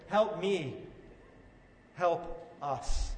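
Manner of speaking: spent, fading plea; helpless plea. Acted. A father out of options begging God, weary and helpless. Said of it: "imagine the father speaking to his son as well".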